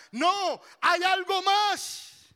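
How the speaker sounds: high, loud, drawn-out exhortation; full-force preaching. Real, not acted. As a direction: proclaim it forcefully from the pulpit.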